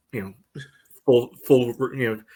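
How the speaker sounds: usual speaking voice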